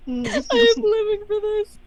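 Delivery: high, laugh-choked delight; helpless laughter. Real, not acted. Player delighting in the game master's puns, helpless laughter. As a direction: squeeze the words out through real laughter.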